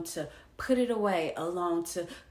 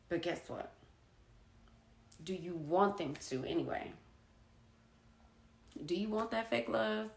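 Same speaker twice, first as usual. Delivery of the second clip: ordinary talk, nothing marked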